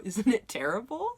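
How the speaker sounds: laughing along at his own ads; guy laughing at his own ads. Retold or imitated. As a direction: chuckle through the line. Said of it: "he laughed with me and was like".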